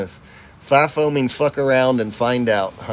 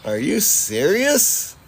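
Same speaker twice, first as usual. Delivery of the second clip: higher and drawn out slowly, rising at the end; stunned disbelief; 7 semitones higher, much slower than usual. Real, not acted. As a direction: let the disbelief stretch every word. Reacting again, more stunned.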